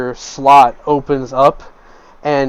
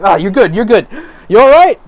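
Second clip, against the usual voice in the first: higher, louder hearty reassurance; reassuring patron; 7 semitones higher, 7 dB louder than usual. Acted. Patron checks the stranger is unhurt, loud hearty reassurance. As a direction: pat him down and reassure him, hammed-up.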